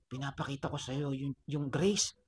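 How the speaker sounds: low, hushed reply; soft explanation. Acted. Struck adult explaining grace to the child, softly. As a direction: drop low and soft, as if enduring it.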